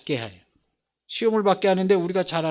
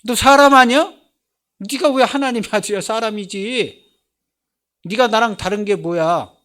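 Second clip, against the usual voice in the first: louder, higher jeering questions; taunting challenge; 4 semitones higher, 5 dB louder than usual. Retold or imitated. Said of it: "내가 볼 때는 기독교인들".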